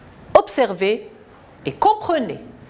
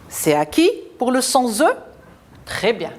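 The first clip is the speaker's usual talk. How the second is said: ordinary talk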